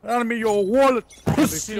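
shouted London roadman mugger voice; roadman accent. Retold or imitated. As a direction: bark it like a London street robber. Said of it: "let me hear your roadman impression".

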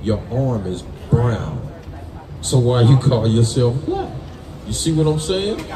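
ordinary talk

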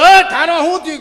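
loud shout across the group; sudden loud hail; calling out. Retold or imitated. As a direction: yell to stop him. Calling out to a lagging man.

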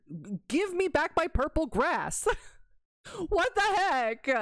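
high-pitched exasperated whine; upset rant. Real, not acted. Streamer demanding the old grass back, upset. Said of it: "I was so upset when I saw that".

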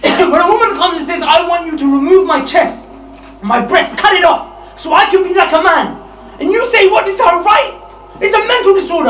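loud, rising sermon voice; fiery preaching. Acted. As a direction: preach it hard at the crowd. Preacher at full force.

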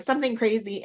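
usual speaking voice

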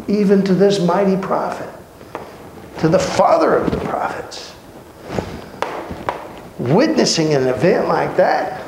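usual speaking voice

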